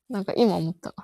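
ordinary talk

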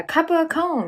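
server's question. Retold or imitated. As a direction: ask it like a busy server. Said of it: "みたいな". (高めの声で店員風に)

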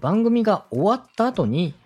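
ordinary talk, nothing marked

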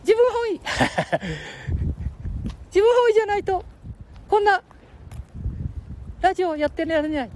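put-on high squeaky boy voice; Ken-chan voice.

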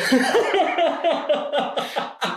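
high, rolling laugh; hearty laughter. Real, not acted. Teammate amused by the admission, hearty laughter. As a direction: burst into a long string of high laughs.

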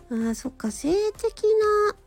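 normal talking voice

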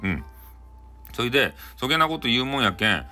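ordinary delivery, nothing marked

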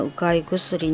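ordinary delivery

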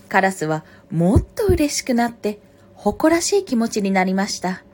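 projected storybook reading, phrase by phrase; story narration. A narrator's reading. Reader telling a fox and crow fable, story narration. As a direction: read aloud as to children, with pauses. Reads a picture book aloud.

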